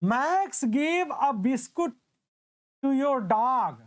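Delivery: very slow, each word spaced out; slow dictation. Shown as a demonstration. Teacher reading an example slowly to students, dictation pace.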